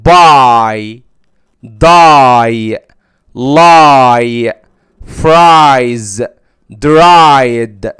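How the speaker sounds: buy, die, lie, fries, dried with a full ai glide; loud, clear model words for the class; long i words. Shown as a demonstration. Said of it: "هتنطق الف وياء".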